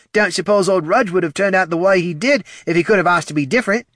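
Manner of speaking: high, loud character voice; ghoul's banter. Acted. Ned jokes about old Rudge, high ghoul voice. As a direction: joke along, pitched well up.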